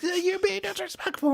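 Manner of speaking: very high, slow scolding impression; a wife scolding her husband at a party, very high and slow; high mock wife voice. Husband mimicking his wife's scolding, very high. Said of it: "she's like".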